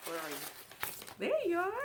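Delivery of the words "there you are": delighted find. Real, not acted. Finding the pillows.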